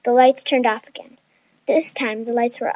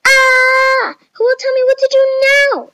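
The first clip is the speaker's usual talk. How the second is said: high, loud, frantic cry for help; screaming in alarm, very high and loud; panicked scream; 13 semitones higher, 8 dB louder than usual. A narrator's reading. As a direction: shriek it in a high-pitched panic. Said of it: "screamed Chloe".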